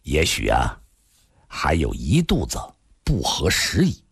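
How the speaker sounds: low, measured man's voice; rueful Li. A narrator's reading. Li answering the old man, wryly rueful. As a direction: answer low with a touch of wryness.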